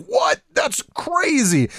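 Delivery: high, drawn-out astonishment; mock disbelief. Imitating James hearing he never played the games, high mock disbelief. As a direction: imitate a friend's squeaky incredulous outcry. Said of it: "he's like".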